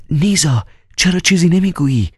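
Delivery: high, wretched pleading; miserable plea. Acted. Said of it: "با حالتی زار". (با حالتی زار و التماس، صدای زیر)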